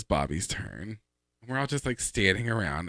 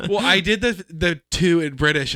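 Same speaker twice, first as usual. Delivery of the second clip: excited; 9 semitones higher, 7 dB louder than usual. Real, not acted. Excited after laughing.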